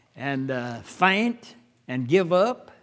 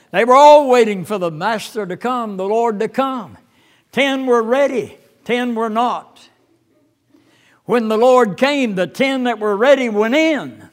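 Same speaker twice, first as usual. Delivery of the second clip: rising, louder preaching cadence; preaching at full force; 4 semitones higher, 8 dB louder than usual. Acted. Pastor to church, preaching fervently about the ten virgins. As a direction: build the sermon up with fervor.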